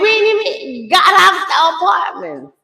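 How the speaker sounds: high loud exasperated shout; exasperated yell. Retold or imitated. Mother shouts in disbelief leaving apartment, high and loud. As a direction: let the panic burst out. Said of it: "I'm like".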